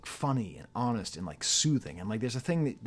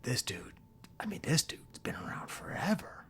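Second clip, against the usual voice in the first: whisper; 5 semitones higher, 5 dB quieter than usual. Real, not acted. Confiding aside about Toure.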